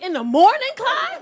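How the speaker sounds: can't believe the hour he named; shocked disbelief. Real, not acted. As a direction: squeal out the name in disbelief. Shocked at six a.m. breakfast.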